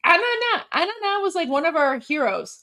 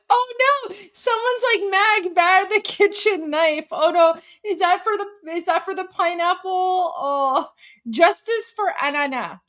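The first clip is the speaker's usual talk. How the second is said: high, playful oh no; feigning panic over the pineapple; mock alarm; 8 semitones higher than usual. Real, not acted.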